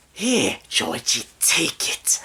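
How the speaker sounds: slow, creepy luring voice; Pennywise. Retold or imitated. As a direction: coax the child slowly, ending in a rasp. Imitates Pennywise luring Georgie.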